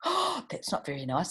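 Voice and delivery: plain reading voice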